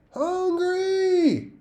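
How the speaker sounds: very high, loud shriek. A narrator's reading. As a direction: shriek like a hungry ghost. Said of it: "you shrieked".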